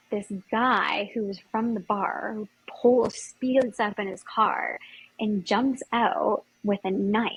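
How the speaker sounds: ordinary talk, nothing marked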